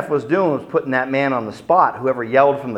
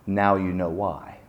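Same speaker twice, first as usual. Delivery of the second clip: low quiet menace; 6 semitones lower, 6 dB quieter than usual. Retold or imitated. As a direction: lower and softer, pointed. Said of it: "And Khrushchev said".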